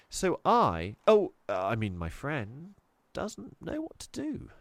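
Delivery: put-on high, hesitant girl covering a lie; nervous fib. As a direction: stumble as you nearly give yourself away. Chloe fumbling a little lie.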